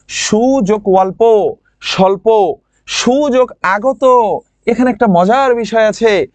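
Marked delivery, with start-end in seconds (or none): 0.1-4.0 slow dictation. Shown as a demonstration. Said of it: "যেমন".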